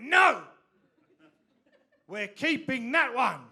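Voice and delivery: put-on high, loud child's protest; child's shout. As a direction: shout it like a small kid who won't let go.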